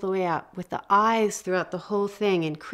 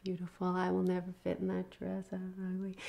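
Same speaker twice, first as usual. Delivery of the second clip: much softer, small defeated voice; mock insecure young woman, hushed; shrinking young woman; 12 dB quieter, faster than usual. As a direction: say it quietly as if shrinking away. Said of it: "start to shrink a little".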